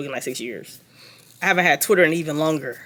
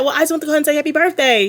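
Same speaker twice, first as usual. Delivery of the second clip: higher, fast sugary mock voice; mocking Brittany; 7 semitones higher, much faster than usual. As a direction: rattle it off like a clingy sweet girl. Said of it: "I cannot stop mocking this girl".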